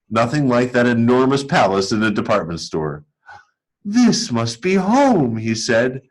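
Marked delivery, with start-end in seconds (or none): 3.9-5.4 1 tender wonder. A narrator's reading. Bear at new home.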